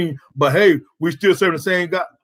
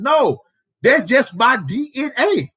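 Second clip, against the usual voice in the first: emphatic denial; 6 semitones higher, slower than usual. Real, not acted. Preacher rebuts imagined accuser.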